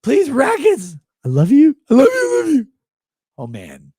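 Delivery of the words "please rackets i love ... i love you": high whiny mocking plea; squeaky mock beg, cracking up high; mock begging fan. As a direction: ham up a pathetic fan begging for love.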